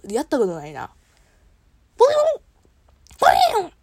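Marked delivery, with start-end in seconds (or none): 2.0-3.7 high, loud quacky duck voice; Donald Duck impression. Streamer trying Donald Duck for the first time, high and loud. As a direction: squawk it like a cartoon duck. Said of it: "ドナルドダックいけるかな".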